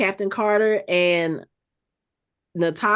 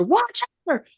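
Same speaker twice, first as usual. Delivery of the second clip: imitated hailing, pitched up high; calling out; 10 semitones higher, faster than usual. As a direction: call for someone far away, without real volume. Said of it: "she starts calling for the Watcher".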